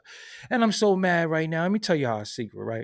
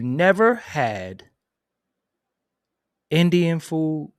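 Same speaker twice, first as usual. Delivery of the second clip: drawn out; 2 semitones higher, 4 dB louder, much slower than usual. Real, not acted. Drawn-out emphasis.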